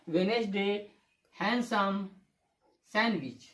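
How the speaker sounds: "वेडनेसडे, हैंडसम, सैंडविच" said wenesday, hansum, sanwich; silent D. Shown as a demonstration. Teacher repeating the corrected examples, silent D. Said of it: "ये डी silent है".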